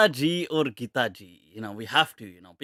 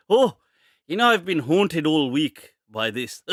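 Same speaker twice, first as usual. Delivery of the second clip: usual speaking voice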